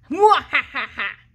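slow hammed-up villain cackle; slow mock-evil laugh at the rival. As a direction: cackle slowly like a cartoon villain. Villain laugh after challenge.

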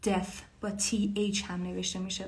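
ordinary delivery

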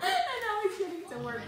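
high voice breaking into laughter; laughing. Real, not acted. Laughing at her baby picking the lock, giggling. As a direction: talk through giggles.

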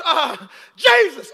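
high, loud, slow snap; snapping at Jesus. Retold or imitated. Martha snapping at Jesus from the kitchen, loud and high. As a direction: bite out the name in a hammed-up snap. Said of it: "She snaps on Jesus".

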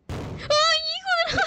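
sudden high frightened shriek; startled scream. Real, not acted. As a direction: shriek from a jump scare. Jump scare in horror game.

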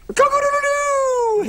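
high crowing rooster call. Pretend. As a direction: crow it out like a rooster. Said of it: "I'm making farm animal noises".

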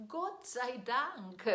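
ordinary talk

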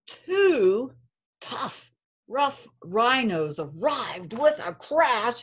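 put-on lilting read-aloud for kids; reading to children. A narrator's reading. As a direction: storytime rhythm, gentle and sing-song. Reads story to class.

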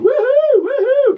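high, loud whooping cheer; mock woohoo. Ladies cheering at a paper-bag costume, loud whoop. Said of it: "they all started going".

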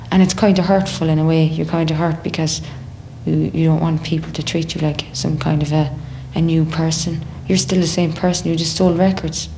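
usual speaking voice

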